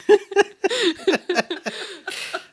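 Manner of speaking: high burst of laughter. Real, not acted. Daughter after telling mother everything, high laughter. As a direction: burst out laughing at your own story.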